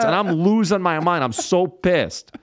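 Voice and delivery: high-pitched, stretched-out venting; worked-up exasperation. Retold or imitated. Comic telling how the stars upset him, high exasperation. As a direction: vent it, dragging out the last words. Reliving his outrage at stars.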